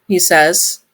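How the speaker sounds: plain reading voice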